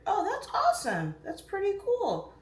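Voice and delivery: high, loud, delighted exclamation; delighted reaction. Real, not acted. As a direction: react with real excitement to a fan. Reacts to a fan's message.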